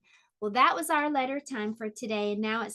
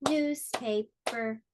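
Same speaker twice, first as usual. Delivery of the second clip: "Newspaper" slowly, each syllable apart; three separate beats on "Newspaper"; split into syllables; much slower than usual. Shown as a demonstration. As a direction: clap out "Newspaper" as news, pa, per. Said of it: "How many?".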